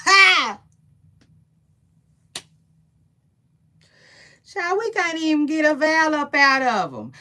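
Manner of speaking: high, loud laugh bursting into the line; cackling laugh. Real, not acted. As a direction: crack up as you say it. Laughing at gossip.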